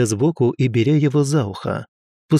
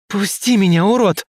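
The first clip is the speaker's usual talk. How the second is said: desperate shout; 9 semitones higher than usual. Acted. Lina grabbed by drunk student.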